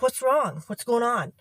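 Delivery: high, loud imitation of the boss's concern. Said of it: "and she goes".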